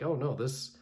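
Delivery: ordinary talk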